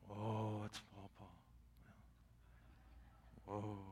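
barely voiced, amazed hush; awed whisper. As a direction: whisper it like a wide-eyed kid. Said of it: "they're looking at me like".

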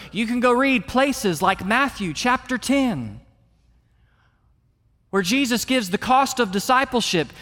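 high, forceful pulpit voice; fervent, emphatic sermon delivery; full-force preaching. Acted. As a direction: preach it out hard, pitched well up.

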